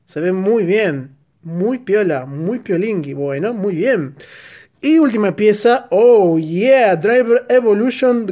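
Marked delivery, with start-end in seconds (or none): none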